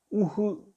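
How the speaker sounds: "우흐" as two separate sounds, oo then huh, high-pitched; sounding out letters. Shown as a demonstration. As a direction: sound out "우흐" piece by piece. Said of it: "이거를 어떻게 읽어야 되냐".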